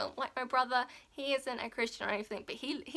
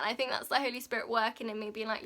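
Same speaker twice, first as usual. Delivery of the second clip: normal talking voice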